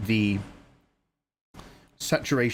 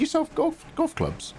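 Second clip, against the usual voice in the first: teasing high question. Real, not acted.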